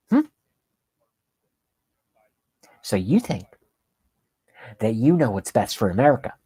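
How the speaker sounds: a hint of smug, condescending anchor; patronizing TV host sneer; mocking Rachel Maddow. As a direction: talk down to the viewer, lightly voiced. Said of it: "this is how she talks to you".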